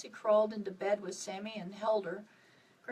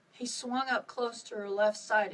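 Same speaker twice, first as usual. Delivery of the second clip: ordinary delivery, nothing marked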